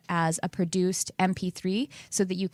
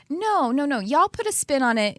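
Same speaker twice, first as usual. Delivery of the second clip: put-on Texas accent; 6 semitones higher, 3 dB louder than usual. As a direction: playfully correct her in a Texas accent. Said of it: "Spirit talks to me that way with the Texas accent".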